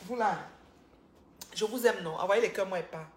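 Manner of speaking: loud calls to the viewers; loud banter asking for hearts; playful loud. Pretend. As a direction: tease the chat loudly. Loud teasing of live viewers.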